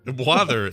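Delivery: "Wather" with a th in place of the t; water turned into "Wather"; mispronounced water. Retold or imitated. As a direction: echo "Wather", th replacing the t of water. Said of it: "he now says wather".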